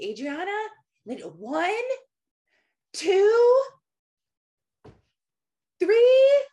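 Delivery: mom's loud, high counting, drawn out and rising; imitated yelling mom. Mom counting at runaway daughter in store, imitated yelling. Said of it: "just yelling at her daughter".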